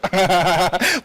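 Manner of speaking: high, delighted burst of laughter; hearty laugh. Real, not acted. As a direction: laugh out loud in a rolling ha-ha-ha. Amused host reacts to guest.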